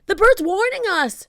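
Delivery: high, loud alarmed pirate; loud high pirate shout; shouted warning. A narrator's reading. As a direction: yell it in alarm. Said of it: "shouted Pinky".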